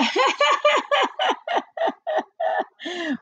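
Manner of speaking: long high-pitched giggle; real laughter. Real, not acted. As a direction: burst into giggles. Laughing at herself.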